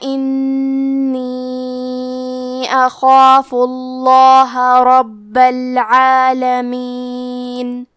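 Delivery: slow, drawn-out melodic tajweed recitation; Quran recitation. Shown as a demonstration. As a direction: intone every verse slowly and evenly. Recites Quran verses slowly. (آہستہ، سریلی قرآن کی تلاوت)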